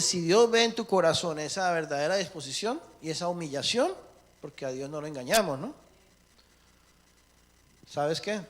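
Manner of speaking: ordinary talk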